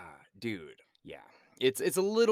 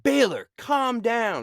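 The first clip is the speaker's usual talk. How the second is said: slow, high, raised-voice admonishment; mock stern order; 12 semitones higher, 7 dB louder, much slower than usual. Superior reining in a police officer, raised imitating voice. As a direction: call him to order, stretched out and louder.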